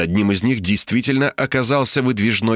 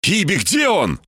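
higher-pitched demanding question; urgent call; 7 semitones higher than usual. A narrator's reading. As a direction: demand an answer, voice raised in pitch. Desperately calls to the assistant. (требовательный окрик повыше)

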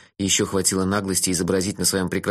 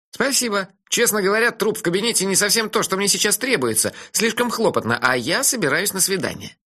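put-on cheeky dreamy young man, higher; teasing friend, pitched up; higher voice for Melifaro; 10 semitones higher than usual. (насмешливый голос Мелифары повыше)

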